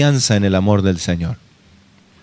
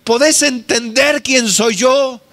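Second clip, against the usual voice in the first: higher, earnest appeal to Marta; pleading preaching; 9 semitones higher than usual. Acted. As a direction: urge her to believe, raising the voice high. Preaching Jesus' words to Marta.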